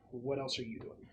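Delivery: usual speaking voice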